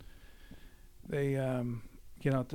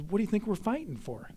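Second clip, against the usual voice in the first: higher-pitched rhetorical question; retold incredulous retort; 7 semitones higher, faster than usual. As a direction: act out a buddy's mock-indignant comeback. Robert urges reluctant friend, retold.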